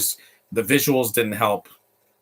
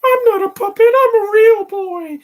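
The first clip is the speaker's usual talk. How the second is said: put-on piercing high, loud puppet-boy voice; very high-pitched cartoon boy impression; Shrek's Pinocchio; 8 dB louder than usual. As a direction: squeak it out loudly in falsetto like a little wooden boy. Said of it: "that voice in Shrek".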